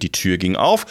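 ordinary delivery, nothing marked

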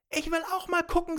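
very high, fast character voice; eager old mother. A narrator's reading. Giant's old mother wants to see the gold, eager high voice. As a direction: pipe up high and quick, wanting a look.

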